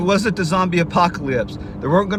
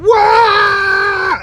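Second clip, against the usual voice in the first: very high, loud excited whoop; wild yell; 10 dB louder than usual. Retold or imitated. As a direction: go nuts like a rowdy kid. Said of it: "yelling and screaming".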